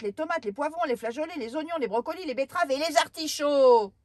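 high, loud whiny complaining; indignant little girl. Acted. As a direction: sulk loudly like a spoilt child. Girl lists hated vegetables.

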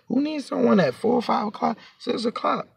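high, hushed questioning; retold incredulity. Speaker retells answering the guy's early-morning demand, incredulous. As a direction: lift the pitch and drop the volume, questioning.

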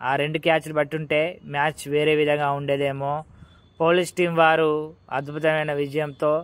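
ordinary delivery

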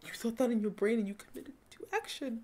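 stunned, fading near-murmur; hushed disbelief. Real, not acted. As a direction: let it fade away to almost nothing. Repeats accusation, stunned.

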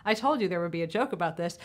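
ordinary delivery

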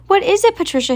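high, loud startled question; alarmed cry. A narrator's reading. Harriet cries out to her screaming sister, high and alarmed. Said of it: "Harriet cried".

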